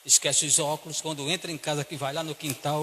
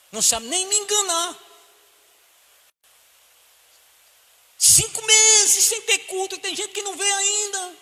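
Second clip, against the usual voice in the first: preaching rebuke; 8 dB louder than usual. Real, not acted. Preacher rebuking absent members, loud and high. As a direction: berate the lazy faithful from the pulpit.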